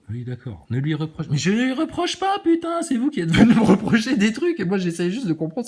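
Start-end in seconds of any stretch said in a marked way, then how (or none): 1.3-5.7 much higher, louder, laughing exasperation; exasperated outburst. Real, not acted. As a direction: burst out in disbelief.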